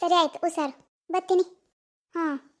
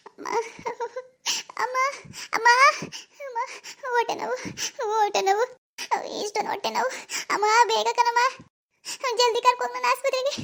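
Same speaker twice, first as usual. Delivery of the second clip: 1 crying in pain; 5 semitones higher than usual. Acted. Daughter crying in labour pain.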